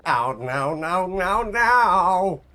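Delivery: the cartoon neighbour's falling-in-tub wail; Cleveland from Family Guy. Streamer doing a cartoon character's rising wail. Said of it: "Cleveland from Family Guy".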